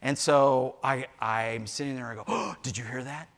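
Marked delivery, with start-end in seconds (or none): 2.3-3.3 soft, low, secretive question; hushed wonder. Retold or imitated. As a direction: hush as if listening in the night. Grandpa hushed, listening to frogs.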